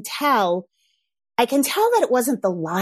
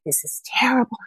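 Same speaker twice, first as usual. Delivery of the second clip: voiceless, whispered imitation of tearful distress; imitating tearful child; 3 semitones higher than usual. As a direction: whisper it like a child about to cry. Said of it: "the lip quiver and he starts shaking".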